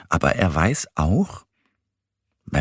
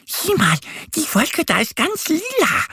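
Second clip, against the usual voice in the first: much higher, bright little duck voice; little duck Annie. A narrator's reading. Duck Annie shows Mo a purple cloud, eager. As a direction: point out the cloud like an eager duckling. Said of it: "sagt Annie".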